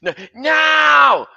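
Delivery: high, loud acted shout of no; victim's scream. Victim attacked by freight car, high screamed protest. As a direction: scream it out in mock terror.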